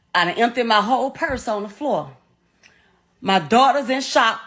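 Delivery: ordinary delivery, nothing marked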